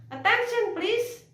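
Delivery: high, projected call for attention; loud call. Shown as a demonstration. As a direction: call out to a busy room. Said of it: "mengucapkannya dengan lantang". (panggilan lantang meminta perhatian)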